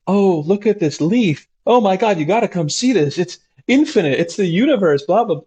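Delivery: high, breathless wonder at a leaf; awed trip voice. Retold or imitated. Therapist imitating a psilocybin patient, high and awestruck. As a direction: gush like someone tripping who sees infinity. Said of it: "they will take the psilocybin, like".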